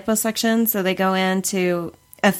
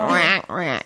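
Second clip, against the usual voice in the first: duck lips voice. Retold or imitated. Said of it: "Where I'm walking around going".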